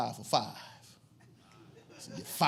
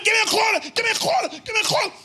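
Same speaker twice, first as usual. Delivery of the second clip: much higher and louder repeated yell; screaming; 11 dB louder, faster than usual. Retold or imitated. Blade screaming for a quarter as the police arrive. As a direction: shout the demand in a frenzy. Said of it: "He's screaming".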